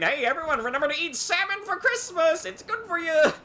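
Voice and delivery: very high, loud mock PSA; monster's cheery announcement. Monster posting a Christmas reminder to fans, high cheery call. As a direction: call it out to everyone, high and peppy. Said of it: "coming back and saying".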